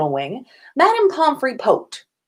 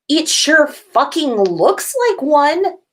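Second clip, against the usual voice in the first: higher, louder exasperated yell; 9 semitones higher, 3 dB louder, slower than usual. Acted. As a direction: yell it in disbelief, a bit slower. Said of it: "still yelling".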